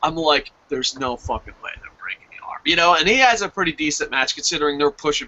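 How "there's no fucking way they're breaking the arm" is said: much softer, near-whispered; hushed disbelief. Retold or imitated. Fan retelling his doubt during the match, hushed.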